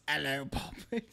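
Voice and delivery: lightly voiced movie pirate greeting; a hint of a Caribbean pirate; playful pirate voice, barely put on; pirate impression. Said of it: "You sound like that guy from Pirates of the Caribbean".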